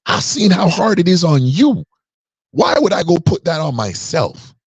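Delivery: high, worked-up disbelief, shouting at "single mom"; incredulous retort. Retold or imitated. Son answering his mother's suggestion, high incredulous voice. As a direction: push up high, appalled at the idea. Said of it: "look at her like".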